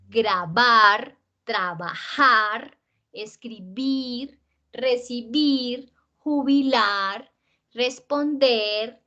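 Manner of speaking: slow, drawn-out last syllable, "trataar, bailaar, cantaar"; exaggerated final stress. Shown as a demonstration. Teacher listing infinitives for students, slow exaggerated stress on final "-ar, -er, -ir". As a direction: dwell on the stressed ending of "tratar", "escribir", "pasear". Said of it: "un poquito exagerada porque no pronunciamos así".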